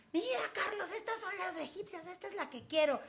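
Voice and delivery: high, soft delighted voice; excited cooing. Retold or imitated. Showing Carlos the Egyptian rats, high soft cooing. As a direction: coo over the rats like a kid in a shop.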